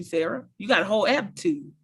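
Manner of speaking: ordinary delivery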